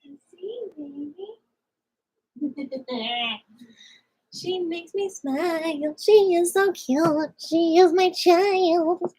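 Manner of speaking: high, drawn-out doting sing-song. Real, not acted. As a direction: coo slowly over your little one in a high voice. Cooing about her child on stream.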